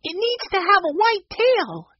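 put-on very high, jokey little-kid voice; joking child. A narrator's reading. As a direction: make the joke as a high-pitched child. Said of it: "Benny joked".

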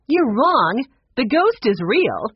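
loud, high, firm contradiction; insistent. A narrator's reading. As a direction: insist she is right, sharply. Woman contradicts grandfather loudly.

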